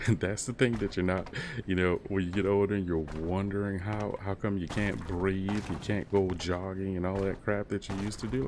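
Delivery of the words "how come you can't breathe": usual speaking voice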